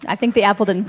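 ordinary talk, nothing marked

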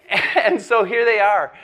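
words laughed out loud; laughing through the line. Real, not acted. Laughing at own joke.

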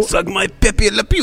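mock French retort. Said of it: "riffing on offended caller".

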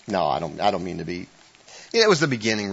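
ordinary talk, nothing marked

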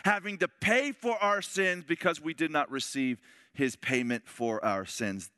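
high, loud fiery preaching; preaching at full force. Acted. Preacher proclaiming judgment to the men, at full force. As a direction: thunder the judgment over the congregation.

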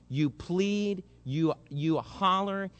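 ordinary delivery

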